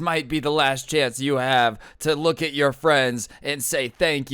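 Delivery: ghost-story flashlight drama, building adrenaline; dramatic speech. Retold or imitated. Kid presenting to class in the dark, dramatic battle narration. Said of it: "he talks dramatically".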